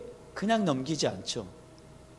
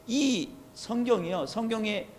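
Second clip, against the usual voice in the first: usual speaking voice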